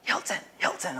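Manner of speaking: hushed whisper to a classmate; imitated classmate's sneaky whisper; whispered call. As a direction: whisper the name to get his attention. Classmate secretly calling him in class.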